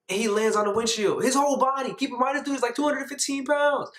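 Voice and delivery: high-pitched disbelief; incredulous telling. Real, not acted. Telling how a friend hit windshield, high incredulity. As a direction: pitch climbs and stays up in outrage.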